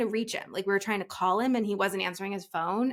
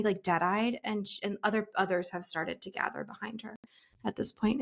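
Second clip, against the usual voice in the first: ordinary delivery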